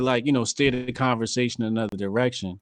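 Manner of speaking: usual speaking voice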